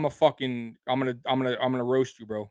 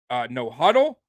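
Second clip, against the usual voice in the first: ordinary talk, nothing marked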